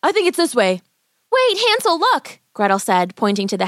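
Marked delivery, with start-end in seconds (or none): 0.0-0.8 put-on high, eager boy's voice; calling boy. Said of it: "Hansel yelled". 1.3-2.4 put-on very high child's call; startled girl. Girl calls brother to look.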